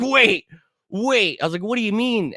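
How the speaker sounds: high, stunned "wait, wait"; mock bewildered protest; shocked disbelief. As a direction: caught completely off guard. His shocked reaction to being fired.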